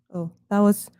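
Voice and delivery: ordinary talk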